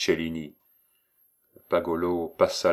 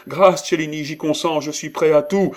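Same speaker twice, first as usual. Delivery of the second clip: high, loud panicked surrender; terrified begging; 9 semitones higher, 7 dB louder than usual. A narrator's reading. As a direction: cry for mercy at knifepoint. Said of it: "s'écria Pagolo".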